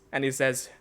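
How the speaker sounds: ordinary talk, nothing marked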